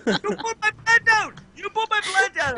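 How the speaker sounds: high-pitched imitation of an angry yell; mock screaming. Retelling a neighbour yelling about his plants, mock screaming. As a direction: screech it in mock outrage. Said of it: "he's screaming at us".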